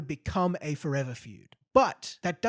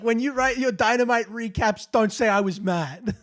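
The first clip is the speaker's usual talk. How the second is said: loud mock warning; 6 semitones higher, 9 dB louder than usual. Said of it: "because he's like".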